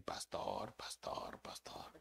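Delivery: imitated hushed whisper, fading away; whispered warning. Congregants warning their sarcastic pastor, imitated whisper. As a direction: breathe it like a secret nudge.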